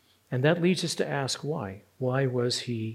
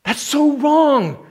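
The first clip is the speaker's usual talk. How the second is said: high, loud, slow outcry; acted indignation; 12 semitones higher, 9 dB louder, much slower than usual. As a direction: cry out the injustice slowly. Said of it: "you're angry about it".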